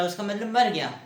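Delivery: ordinary talk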